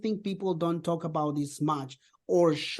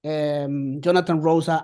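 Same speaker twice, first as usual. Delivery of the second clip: ordinary talk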